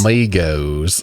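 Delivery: slow, drawn-out gringo "amigos"; American-accented Spanish, vowels stretched; mock gringo accent. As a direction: echo it in a hammed-up American accent. Echoing co-host's gringo accent joke.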